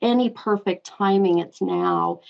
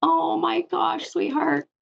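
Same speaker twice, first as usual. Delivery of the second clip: moved almost to tears by the name; choked up; 8 semitones higher than usual. Real, not acted. Medium to caller, genuinely choked up at the name. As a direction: voice catching and pitched higher, fighting tears. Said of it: "I'm gonna get choked up".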